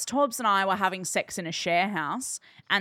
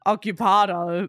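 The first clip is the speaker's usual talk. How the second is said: deep voice imitation; 2 semitones lower than usual. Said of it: "Go on".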